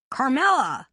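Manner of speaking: loud, high call in a man's put-on voice; the hunter calls her name into the woods. A narrator's reading. Bannister calls out to the hiding Carmela, loud. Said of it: "Bannister suddenly called out".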